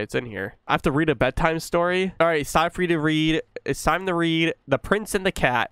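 usual speaking voice

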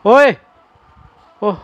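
sudden loud, high yelp; startled cry. Real, not acted. Player startled by the ghost girl, loud high cry. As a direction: jolt out a startled exclamation.

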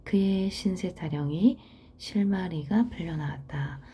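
normal talking voice

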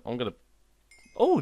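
ordinary talk, nothing marked

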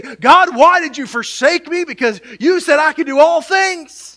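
high, loud, whiny cry to God; a sulking child blaming God; pouting complaint. Retold or imitated. Believers complaining to God when stuck, loud pouting whine. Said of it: "and we pout, and we say".

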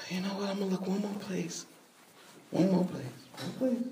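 hushed, barely voiced self-talk while searching; muttering to herself. Woman sweeping her dirt floor, talking to herself, hushed and weary. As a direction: mumble it under your breath, worn out. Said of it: "here she says".

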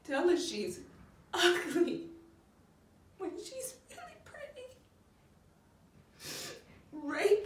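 high, crying voice with bursts of force; tearful breakdown. Acted. Mother grieving what the world does to her girl, tearful breakdown. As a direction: break down in sobs, gasping between phrases.

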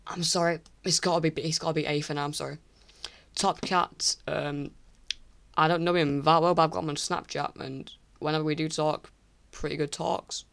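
ordinary delivery

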